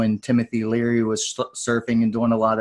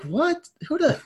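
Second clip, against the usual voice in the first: much higher, squeaky "What? Who the?" with a rising "What"; stunned disbelief; 3 dB quieter than usual. Retold or imitated. Newcomer hears he's one of the best, high stunned squeak. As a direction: sputter in shock, pitch way up.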